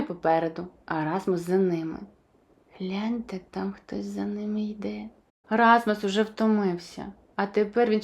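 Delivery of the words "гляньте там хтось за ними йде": hushed warning whisper. A narrator's reading. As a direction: breathe it like a secret. Warning listeners of a follower.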